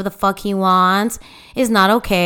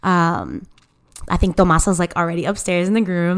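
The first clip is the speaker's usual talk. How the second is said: ordinary talk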